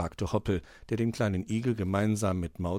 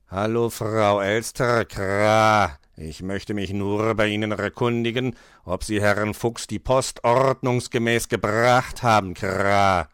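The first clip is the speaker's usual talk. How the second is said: slow cawing bird with rolled r's; officious raven; 3 semitones lower, 3 dB louder than usual. Acted. Raven checks on magpie's post delivery, cawing. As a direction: play a raven, croak out each Kra. Raven caller voiced with caws.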